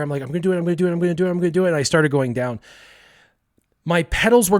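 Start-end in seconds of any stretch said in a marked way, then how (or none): 0.2-1.7 higher, rapid repeated self-urging; psyching himself up. Acted. Kid psychs himself up on the bike, urgent chant. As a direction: build nerve with a driven, pitched-up chant.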